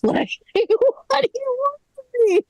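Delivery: very high, cracking mock-wail; hammed-up, high-pitched tearful exasperation; exasperated plea. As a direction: squeal it in comic despair. Acted exasperation at absurd complaint.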